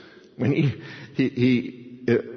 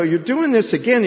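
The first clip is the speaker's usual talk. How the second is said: high, mock-exasperated accusation; scolding parent; 10 semitones higher, 4 dB louder than usual. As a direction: re-enact telling off a son. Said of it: "I go".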